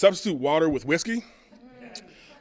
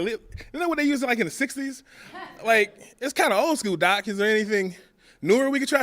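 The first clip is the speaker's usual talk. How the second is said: incredulous protest; 8 semitones higher than usual. Retold or imitated. Comic protesting to the doctor about lithium, incredulous and high. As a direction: push back, amazed.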